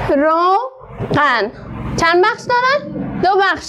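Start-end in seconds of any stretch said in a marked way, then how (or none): 0.1-1.5 روغن said very slowly, split into two drawn-out syllables. Shown as a demonstration. Teacher syllabifying روغن for pupils, very slow. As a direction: stretch each syllable of روغن for the class.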